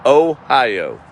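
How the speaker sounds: Ohio with a laugh, falling, pleased to get it right; laughing, got the name right at last. Shown as a demonstration. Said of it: "There we go. I got it right".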